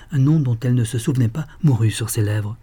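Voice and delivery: ordinary delivery, nothing marked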